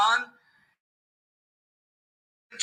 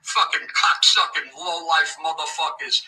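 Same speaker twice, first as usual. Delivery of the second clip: imitating tough guy; 7 semitones lower than usual. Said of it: "trying to act like Nick".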